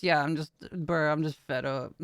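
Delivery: low, quiet, worn-out voice; tired voice. Real, not acted. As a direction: sigh it out, exhausted, half laughing. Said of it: "[name] tired bitch voice?".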